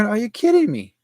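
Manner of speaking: high, disbelieving outburst; mock shock at the computer; retold disbelief. A man who finds his computer jumped back a day, disbelieving. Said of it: "I go".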